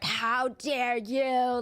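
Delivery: very slow, drawn-out mock outrage; mock indignant. Shown as a demonstration. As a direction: stretch it like a grand offended protest. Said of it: "it's not like".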